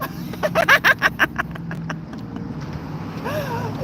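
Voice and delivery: long, high wheezing laugh; real laughing fit. Real, not acted. Streamer cracking up at a chat comment, high laughing fit. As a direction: crack up helplessly.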